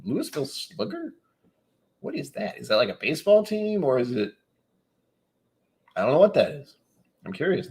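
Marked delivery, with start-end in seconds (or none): 0.0-4.3 puzzled. Real, not acted. Puzzled reading of chat.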